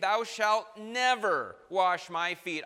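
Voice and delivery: loud, slow pronouncement with NEVER stressed; decree from the throne. Acted. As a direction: hand it down as a final royal ruling. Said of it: "The decree has come down from the throne".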